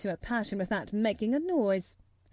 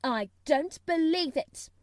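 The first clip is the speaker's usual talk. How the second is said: higher-pitched, sour disbelief; 6 semitones higher, 4 dB louder than usual. A narrator's reading. Mary doubting Colin's illness, sour. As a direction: dismiss it sourly. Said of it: "said Mary sourly".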